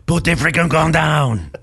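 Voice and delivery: put-on loud, high police command in a thick French accent; French-accented police shout. French friend yelling at a suspect, French-accented shout. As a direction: bark it like a French cop on patrol. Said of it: "very thick French accent".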